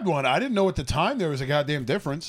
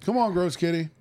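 pet talk; 10 semitones higher than usual. Real, not acted. Coaxing the cat over.

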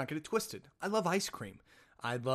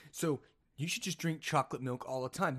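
normal talking voice

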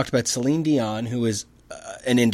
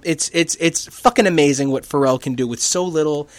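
usual speaking voice

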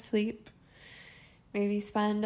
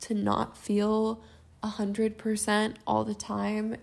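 ordinary delivery

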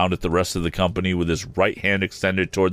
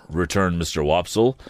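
plain reading voice

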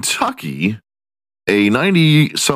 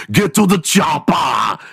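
mock Schwarzenegger shout; 7 semitones higher than usual. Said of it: "be like".

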